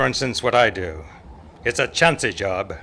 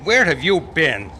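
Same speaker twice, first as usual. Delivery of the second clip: loud, higher call across the wind; calling back; 5 semitones higher, 4 dB louder, slower than usual. Acted. Marshal calling to Chester in the storm, loud. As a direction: shout back to the deputy. Marshal answers his lost deputy.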